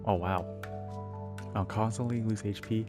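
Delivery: ordinary talk, nothing marked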